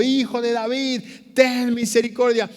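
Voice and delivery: high, full-voiced cry for mercy; persistent shouting. Blind man ignores the scolding crowd and calls Jesus, shouting. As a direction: keep yelling, loud and undeterred. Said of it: "siguió gritando".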